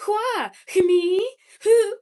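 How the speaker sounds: high, loud mid-Atlantic with WH on everything; mid-Atlantic girl. Shown as a demonstration. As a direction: spoof a mid-Atlantic accent, puffing out each WH. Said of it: "mid-Atlantic, uh, girl who just adds, like, the W H sound to, like, everything".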